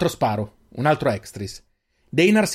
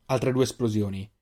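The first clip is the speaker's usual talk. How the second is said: ordinary delivery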